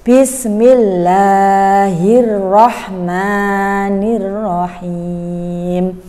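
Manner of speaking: slow chanted basmalah; prayer recitation. Acted. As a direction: recite the prayer slowly and reverently. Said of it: "marilah kita membaca basmalah".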